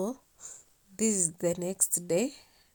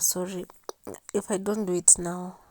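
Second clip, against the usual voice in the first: ordinary delivery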